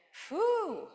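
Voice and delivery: breathy high phew of relief; relieved laughter. Real, not acted. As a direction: let out an overwhelmed exhale. Overwhelmed exhale during worship.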